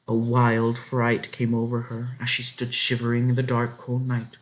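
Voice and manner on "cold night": ordinary delivery, nothing marked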